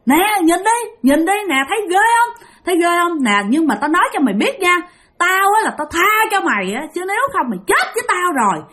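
high-pitched, confrontational ghost woman; menacing demon woman. Retold or imitated. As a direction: challenge a trespasser as an angry demon. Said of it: "Xong bả sấn". (giọng bà quỷ hăm dọa, cao gắt)

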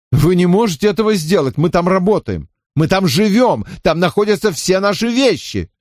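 твёрдо. A narrator's reading. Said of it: "твёрдо заявил".

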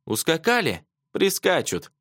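high, sarcastic echo question; wry sneer. A narrator's reading. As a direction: throw the word back scornfully. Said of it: "криво усмехнулся Антон".

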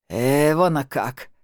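put-on slow, slurred drunk admiration; tipsy, tongue tangled, delighted; drunk slurring. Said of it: "заплетающимся языком восхитился Виталик".

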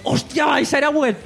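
high, excited outburst; excited shout. Real, not acted. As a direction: blurt it out in delight. Sudden excited recognition.

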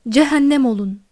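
loud cry of rage near tears; tearful shout. A narrator's reading. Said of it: "diye bağırdı". (ağlamaklı öfkeyle bağırarak)

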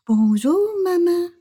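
butter her up with a honeyed greeting. Acted. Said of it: "puis le voix mielleuse".

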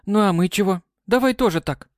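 eager. Acted. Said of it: "поддержала Май".

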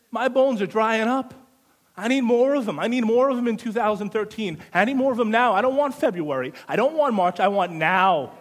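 loud, driving preacher's build to a peak; fiery preaching. Acted. Preacher urging the congregation, loud full-force preaching. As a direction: preach it hard, rising and pushing the crowd.

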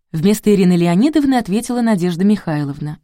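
plain reading voice

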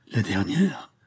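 La dernière? breathed out as a stunned whispered question; heartbroken cry. A narrator's reading. Anne hears it is their last meeting, whispered anguish. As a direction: gasp La dernière? in a hushed, unvoiced shock. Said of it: "Un cri déchirant accompagna cette exclamation".

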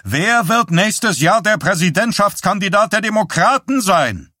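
high, blaring tuba-like voice; brassy, booming demand; loud, booming. A narrator's reading. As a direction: blast the question across the press room. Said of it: "mit lauter, dröhnender, tuba-ähnlicher Stimme".

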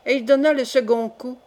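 ordinary delivery

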